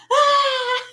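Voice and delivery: high, loud re-enacted shriek; mock-terrified scream; scared scream. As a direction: shriek back like a frightened child. Her scared reply scream.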